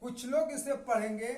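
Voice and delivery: normal talking voice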